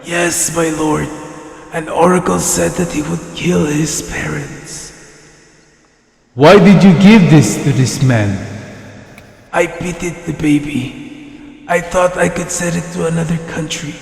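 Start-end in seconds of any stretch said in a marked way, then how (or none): 0.0-4.9 put-on quiet, fearful old servant; hushed, reluctant old herdsman; timid old shepherd. 9.5-13.9 put-on quiet, fearful old servant; hushed, reluctant old herdsman; timid old shepherd.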